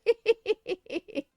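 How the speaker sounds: amused, laughing while talking; real laughter bubbling through the words. Real, not acted. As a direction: say it through laughter, then break into high giggles. Laughing at her own story.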